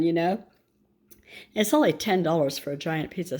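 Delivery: ordinary delivery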